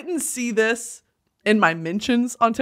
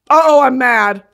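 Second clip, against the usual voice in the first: mock mad; 3 semitones higher, 8 dB louder than usual. Real, not acted.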